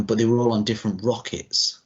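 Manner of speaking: ordinary talk, nothing marked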